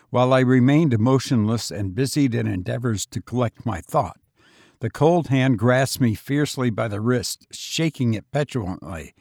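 plain reading voice

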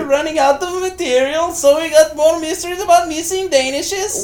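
high put-on producer voice; high mock voice. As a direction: squeak it like a silly producer. Playing show producers.

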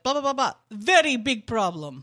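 put-on babble, then thick Bosnian-accented complaint; imitated Bosnian accent. Host imitating coworker's native tongue, Bosnian-accented babble. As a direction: mimic riled-up jabber in a Bosnian accent. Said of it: "he would just be like".

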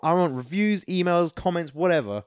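usual speaking voice

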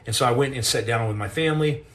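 normal talking voice

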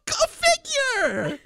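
very high, slow mock wail; mock outrage.

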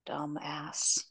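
soft unvoiced whisper of contempt; muttered insult. Real, not acted. Podcaster judging the husband to cohost, whispered. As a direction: breathe it out in disgust.

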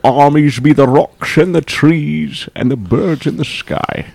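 hammed-up Scottish movie-star voice; Sean Connery. Grandly reciting to laughing podcast friends, Sean Connery impression. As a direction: declaim it like the famous Scottish actor. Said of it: "Was that your Sean Connery voice?".

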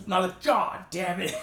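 high, loud and slow pained curse; mock pained outburst; imitated pained curse. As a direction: cry out as the broken ankle moves. Ankle breaking while lifting.

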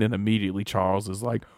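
ordinary talk, nothing marked

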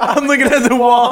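words through a laugh; giggling as he tells it; laughing retelling. Real, not acted.

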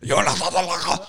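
gurgling, fast, as if gargling mouthwash; while gargling. Retold or imitated. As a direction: say it through a gargle. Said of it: "are you gargling mouthwash?".

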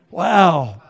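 normal talking voice

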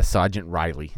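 ordinary talk, nothing marked

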